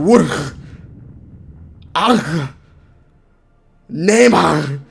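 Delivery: "Worst", "Ugh", "Neymar" ending in harsh throaty G rasps; mock Dutch G. Streamer mimicking a Dutch guy, guttural G on "Worst", "Ugh", "Neymar". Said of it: "he's like".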